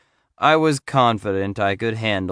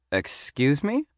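high, softer query rising at the end; taken-aback grandmotherly voice, pitched up; grandmotherly receptionist; 5 semitones higher, 4 dB quieter than usual. A narrator's reading. Receptionist startled by a serial killer question, grandmotherly voice. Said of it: "sounded like someone's grandmother".

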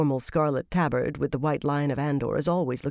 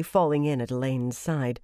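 usual speaking voice

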